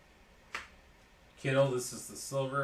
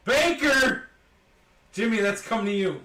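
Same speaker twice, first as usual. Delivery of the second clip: high, loud burst of excitement; excited shout; 8 semitones higher, 11 dB louder than usual. Real, not acted. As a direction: shout it out like a big pull. Joke shout at a card pull.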